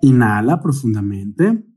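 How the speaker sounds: unhurried meditation guide, long pauses between cues; guided meditation. Real, not acted. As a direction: guide the breathing calmly, stretching out each cue word. (meditación guiada, con calma y largas pausas)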